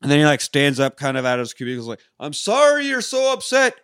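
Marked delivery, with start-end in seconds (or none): 2.2-3.8 very high, put-on announcing voice; mock apology called out for everyone to hear; hammed-up high-pitched fake sympathy; staged apology. Clerk loudly fakes apology for onlookers.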